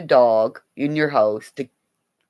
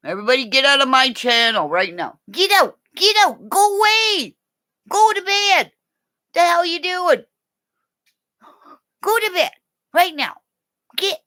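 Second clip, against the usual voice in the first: mock shouting; 4 dB louder than usual. Playfully chasing viewers off channel.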